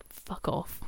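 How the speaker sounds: under-the-breath whispered curse. Pretend. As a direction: hiss it out unvoiced. Mock dismissal of trainer wearers.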